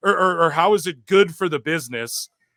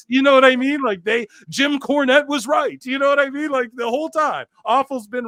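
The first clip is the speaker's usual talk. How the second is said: heated rant; 9 semitones higher than usual. Real, not acted. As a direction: pitch climbing high in frustration. Ranting, voice climbing high.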